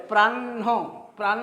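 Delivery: "প্রাণ" with held n and an added breath of ho; n before h. Shown as a demonstration. Teacher introducing the next word, প্রাণ as "prann-ho". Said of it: "আগে মূর্ধন্য উচ্চারণ পরে হয়ের".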